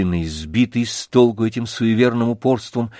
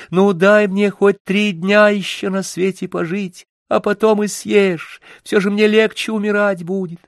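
higher, lightly voiced pleading little hare; 9 semitones higher than usual. A narrator's reading. Hare begs the wolf to spare him, higher pleading. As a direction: beg the wolf for mercy. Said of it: "Тогда заяц говорит".

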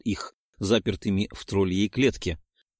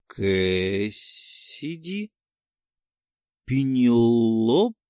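slow, stunned disbelief; much slower than usual. A narrator's reading. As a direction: say the names in shock. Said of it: "ошарашенно проговорил он".

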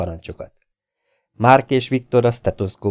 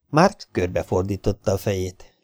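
plain reading voice

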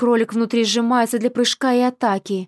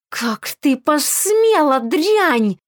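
slow, hissing rage; furious hiss; 5 semitones higher, 3 dB louder, much slower than usual. A narrator's reading. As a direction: hiss it out like a snake. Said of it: "свистяще шипит принцесса".

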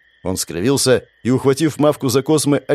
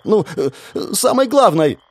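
babbling; 9 semitones higher than usual. A narrator's reading. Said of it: "Она пролепетала".

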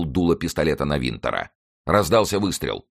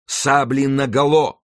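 high, loud, drawn-out order; battle command; 9 semitones higher, 5 dB louder, slower than usual. A narrator's reading. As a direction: call it across a cavalry line. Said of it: "раздалась команда Винтера". (протяжная громкая команда)

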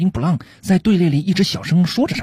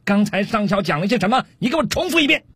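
high-pitched officer's orders; acted captain hollering at his subordinate; acted shouting; 8 semitones higher than usual. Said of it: "听到上尉大声嚷嚷".

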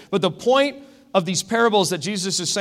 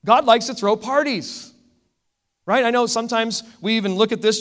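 normal talking voice

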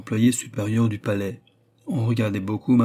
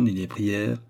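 ordinary delivery

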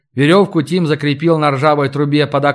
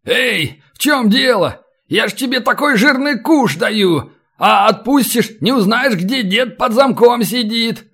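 high-pitched urgent protest; animated prisoner arguing for his life; bargaining captive; 7 semitones higher than usual. Acted. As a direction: plead and tempt with a hidden prize.

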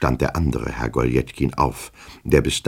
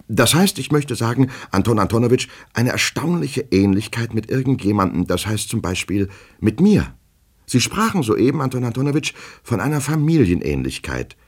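high, urgent clerk voice; nervous, high-pitched explanation; agitated petty clerk; 8 semitones higher than usual. Acted. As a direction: press the point anxiously.

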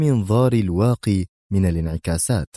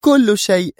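high, louder, firm final words; Sonia firm; 13 semitones higher, 5 dB louder, slower than usual. Acted. Sonia firmly echoes that all can be rebuilt, high and louder. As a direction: seal it with resolve. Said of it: "في حزم".

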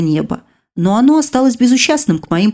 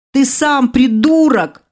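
slow, higher furious yell; yelling at him; 6 semitones higher, slower than usual. A narrator's reading. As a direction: shout it into his ear. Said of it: "заорала ему в ухо". (медленный громкий крик в ухо)

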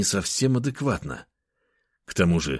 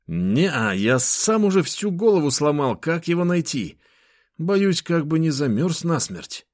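put-on young friend voice; 9 semitones higher than usual. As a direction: voice the worried friend, pitched up. Nikita voiced in dialogue.

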